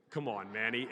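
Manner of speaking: normal talking voice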